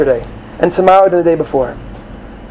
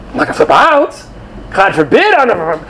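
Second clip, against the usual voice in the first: higher, mocking voice of a fretting student; mock panicky student; 3 semitones higher than usual. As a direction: play the anxious kid protesting. Mocking students who flip out.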